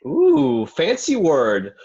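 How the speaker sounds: high, loud mocking "ooh", then slow and drawn out; mocking tease. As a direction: sneer at the big word, high and drawn out. Mocking Andy's vocabulary.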